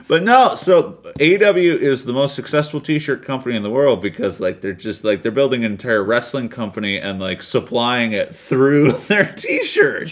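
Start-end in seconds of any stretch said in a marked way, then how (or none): none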